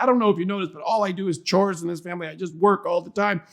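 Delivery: high, mock-whiny complaining daughter; grumbling preteen. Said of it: "mumbling and grumbling".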